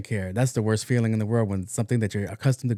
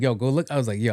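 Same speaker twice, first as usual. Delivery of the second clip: normal talking voice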